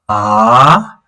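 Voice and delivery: "ah" sliding upward, second tone; rising tone. Shown as a demonstration. Teacher demonstrating the rising second tone on "ah". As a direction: let "ah" climb up like a question. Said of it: "it will be like".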